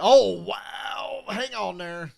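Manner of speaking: alarmed shout at the screen; startled outburst. Real, not acted. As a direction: react to a car nearly wrecking. Sudden near-wreck on track.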